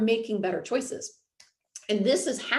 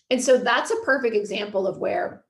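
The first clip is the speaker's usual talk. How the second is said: usual speaking voice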